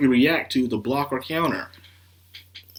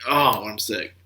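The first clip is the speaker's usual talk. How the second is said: ordinary talk, nothing marked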